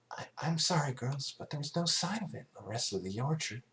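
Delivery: timid little pony, sorry to disappoint; timid Fluttershy. Acted. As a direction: apologise meekly as a shy pony. Fluttershy's apology in story.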